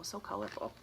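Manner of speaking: ordinary talk, nothing marked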